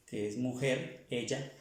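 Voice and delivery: ordinary talk, nothing marked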